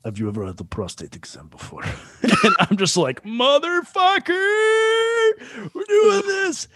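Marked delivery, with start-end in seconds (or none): 0.0-1.8 low, soft Russian doctor impression; rough Russian accent. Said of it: "a really good Russian accent". 3.2-6.7 very high, drawn-out comic wail; mock anguish. Retold dismay at the doctor's question.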